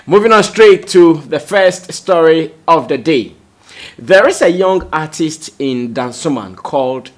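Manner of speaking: bright, loud segue into the story; loud announcer. Real, not acted. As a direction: announce it with punch. Anchor announcing next story.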